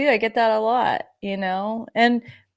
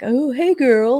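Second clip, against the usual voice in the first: bubbly greeting; 6 semitones higher, 4 dB louder, slower than usual. Retold or imitated. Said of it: "I don't expect anybody to be like".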